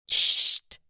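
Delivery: hissed shh for silence; sharp loud shush. A narrator's reading. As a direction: shush the talker firmly. Shushing in meditation room.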